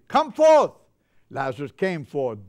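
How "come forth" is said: much higher and louder shout at the tomb; Jesus shouting. Retold or imitated. Jesus calling Lazarus from the grave, big shout. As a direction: call the dead out of the grave. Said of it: "he shouted at the grave".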